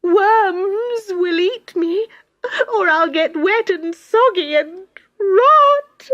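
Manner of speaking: high, slow, tearful wail; weeping little tree on the rubbish pile; sobbing. Acted. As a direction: keep crying through the fear. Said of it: "she sobbed".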